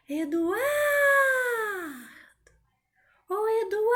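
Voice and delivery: high call with a very long AR; calling Eduardo. Shown as a demonstration. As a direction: call out to someone missed, stretching the stressed vowel. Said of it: "Vou chamar você".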